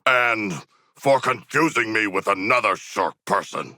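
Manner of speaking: usual speaking voice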